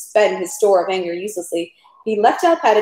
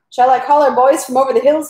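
louder, higher, eager call; shouted question; 5 semitones higher, 5 dB louder than usual. A narrator's reading. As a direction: call out the offer in a raised voice. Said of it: "he shouted".

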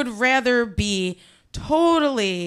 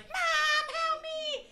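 very high, thin, faint attempted scream; strangled cry for mom; 10 dB quieter, much slower than usual. Panicking teen calling for mom, strangled high squeak. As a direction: try to scream but only a tiny squeak comes out. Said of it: "thinking I'm screaming for my mom".

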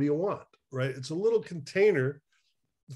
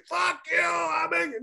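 much higher, hammed-up shout at home; mock angry shout; 14 semitones higher than usual. A man yelling at his spouse after the retreat, mock shout. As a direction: blow up like a man fresh from a weekend.

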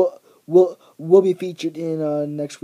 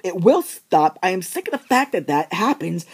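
ordinary talk

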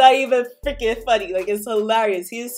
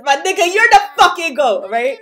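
loud, high, laughing hype; laughing cheer; 6 semitones higher, 7 dB louder than usual. Real, not acted. Fan laughing and cheering at Rimuru saving kids.

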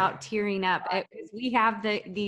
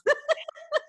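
high, light laugh at herself; embarrassed laugh. Real, not acted. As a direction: laugh off the tears. Laughing at own tears.

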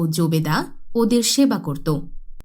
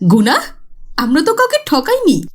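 put-on louder, higher indignant girl; defensive retort; 7 semitones higher, 6 dB louder, faster than usual. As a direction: brush off the charge. (ধরা পড়ে রাগত অস্বীকার করা মেয়ে)

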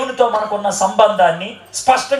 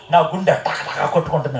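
mock frightened person; 7 semitones lower, 4 dB quieter than usual. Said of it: "చాలా మంది ఏమంటారు తెలుసా?".

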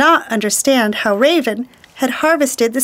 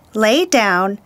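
plain reading voice

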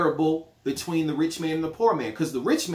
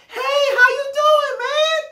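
much higher and louder, fake-friendly phone greeting; overeager cousin; 6 dB louder than usual. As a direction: gush like a long-lost cousin after money. Acted phone call, cousin caller.

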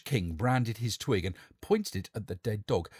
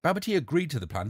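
ordinary delivery, nothing marked

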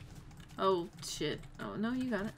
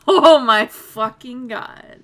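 loud laughing exclamation; 20 dB louder, slower than usual. Real, not acted. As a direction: laugh it out slowly.

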